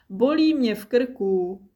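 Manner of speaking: plain reading voice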